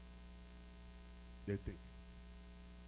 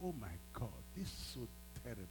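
remorseful; 4 semitones lower, 11 dB quieter than usual. Retold or imitated. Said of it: "I said".

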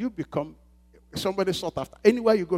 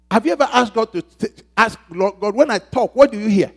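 preaching at full force; 3 semitones higher, 9 dB louder than usual. Acted. Pastor urges the congregation to ask God what He hears, loud preaching. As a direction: press the questions home from the pulpit.

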